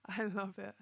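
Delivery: real amusement, laughing after the words; cracked up. Real, not acted. Streamer recalling viewers' very British food answers, cracked up. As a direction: say it while tickled, breaking into laughter. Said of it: "You guys crack me up so much".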